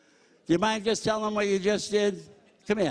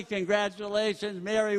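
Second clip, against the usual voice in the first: ordinary talk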